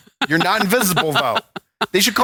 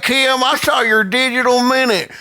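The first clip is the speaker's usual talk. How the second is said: folksy Southern fan calling out; Southern accent; 7 semitones higher than usual. Pretend. Imagined Southern fan praising the host, Southern accent. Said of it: "now in a Southern accent".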